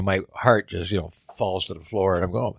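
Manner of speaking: ordinary talk, nothing marked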